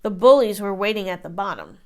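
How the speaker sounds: usual speaking voice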